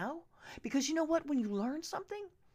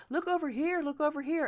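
mocking Trump dodging; 6 semitones higher, 3 dB louder than usual. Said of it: "He danced around that".

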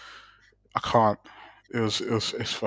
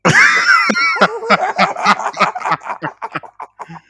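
big real burst of laughter, almost a shout; loud laughing outburst; 7 dB louder than usual. Real, not acted. Scoffing at friends who skipped Devlin, loud burst of laughter. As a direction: let the laugh explode.

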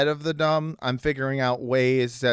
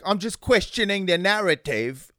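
1 Russell Brand impression; 7 semitones higher than usual. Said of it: "Oh, I can't do his accent".

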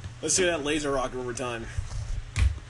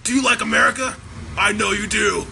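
monster truck ad; 3 semitones higher than usual. Pretend. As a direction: pump up the crowd.

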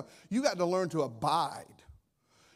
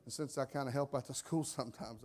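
ordinary talk, nothing marked

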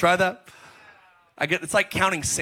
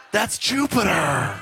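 amazed discovery; slower than usual. Retold or imitated. Acting out amazed discovery of Jupiter.

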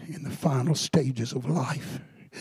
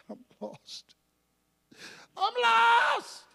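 echoing the dying man's wail; desperate cry; 4 dB quieter, much slower than usual. Retold or imitated. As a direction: let the despair burst out. Preacher re-enacts dying man's cry.

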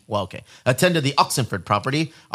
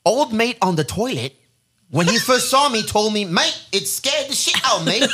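higher-pitched Aussie bloke voice; Australian accent; 7 semitones higher than usual. Shown as a demonstration. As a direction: play a hammed-up Queenslander. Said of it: "I'm gonna do my best quick for this accent".

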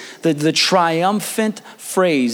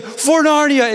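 high, earnest rallying cry; dead-serious battle cry; 11 semitones higher, slower than usual. Retold or imitated. Freshman leading the cheer, dead-serious battle cry. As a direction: imitate a solemn war cry, pitched up. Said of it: "he's dead serious".

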